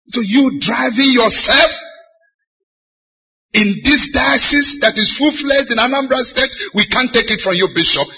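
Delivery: high, loud, indignant rebuke; fuming knight. Retold or imitated. Knight scolds the bishop over his self-driving, fuming. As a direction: imitate a fuming man telling off his bishop. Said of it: "The man was fuming".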